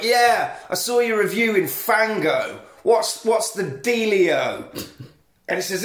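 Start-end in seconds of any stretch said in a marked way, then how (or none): 0.0-4.7 put-on higher, slow, cocky nineties teen; mocking teen Edward. Mocking the film's hero on the phone, cocky teen voice. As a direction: send up a too-cool kid. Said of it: "And he's like".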